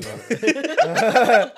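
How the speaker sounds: slow, loud, laughing through it; can barely get it out for laughing; cracking up while asking; laughing question. Real, not acted. Laughing at the bagel shop mixup.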